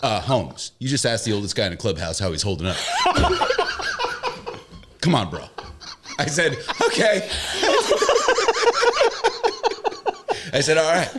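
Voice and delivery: laughing high through the punchline; can't stop laughing while wrapping up; wrapping up the story to the host, laughing. Real, not acted. Still laughing finishing the story.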